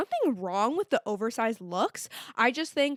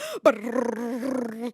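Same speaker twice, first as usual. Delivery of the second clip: mock gasp. Lying in bed, racing thoughts.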